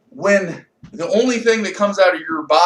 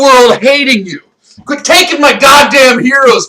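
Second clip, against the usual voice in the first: furious shouting tirade; raging rant; 7 semitones higher, 10 dB louder than usual. Real, not acted. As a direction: rage at cancer at the top of your voice. Raging at cancer.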